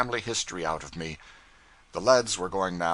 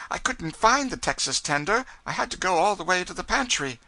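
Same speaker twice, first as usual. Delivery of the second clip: nervous excuse; 10 semitones higher, 4 dB louder than usual. A narrator's reading. Cub excusing himself.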